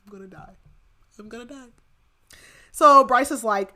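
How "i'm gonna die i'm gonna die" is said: much softer, fading repeat; quiet dying words. Retold or imitated. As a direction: let it slip away to almost nothing. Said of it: "And Lahaba's like".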